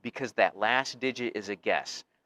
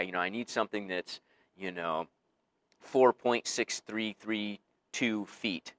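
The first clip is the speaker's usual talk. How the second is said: mock overprecise; slower than usual. Customer at lumber store, mock.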